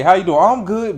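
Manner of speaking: high, loud, chirpy small talk; put-on happy. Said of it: "they wanna look happy".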